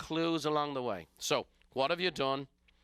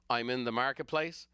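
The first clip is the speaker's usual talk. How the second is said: ordinary talk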